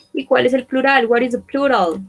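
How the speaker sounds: usual speaking voice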